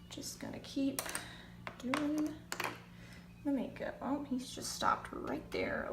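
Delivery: soft, under-the-breath commentary; hushed. Real, not acted. An old man stares while she does makeup, softly.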